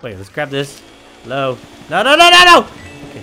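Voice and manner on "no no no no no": high, loud panicked no's; frantic yelling no. Real, not acted. Player yelling as the ghost escapes, frantic. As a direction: shout it as the ghost slips away.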